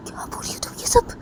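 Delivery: breathless unvoiced disbelief; shocked whisper. Pooja whispering in shock at Poonam's story. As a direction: gasp it out in disbelief. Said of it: "पूजा वो पूनम को बोलती".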